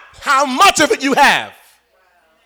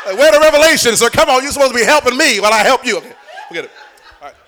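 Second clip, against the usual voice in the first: usual speaking voice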